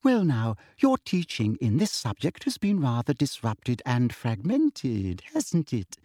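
high, prim ministry teacher; sugary teacher voice. Acted. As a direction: lecture sweetly like a prim official. Umbridge lecturing class.

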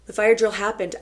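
ordinary delivery

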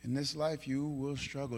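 low, very soft restatement; quiet, sober pulpit voice; hushed. Real, not acted. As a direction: bring it right down, almost intimate. Same words repeated in hushed voice.

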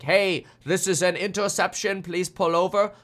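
mock pilot radioing in a German accent. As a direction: put on a German accent, pitched slightly up. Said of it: "I'm using a German accent".

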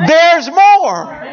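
loud, high announcer shout; infomercial pitchman. Retold or imitated. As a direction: belt it like a TV pitchman. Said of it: "they say this".

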